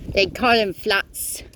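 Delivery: slow, hammed-up British accent; terrible British accent. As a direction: put on a bad British accent, slowly. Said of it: "Stop using that terrible accent".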